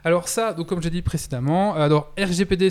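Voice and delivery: normal talking voice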